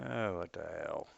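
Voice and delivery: faint, breaking-up mic audio; barely audible through a bad connection; cutting out. Real, not acted. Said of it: "You're cutting out there pretty bad".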